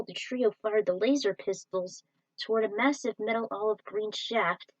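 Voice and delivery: usual speaking voice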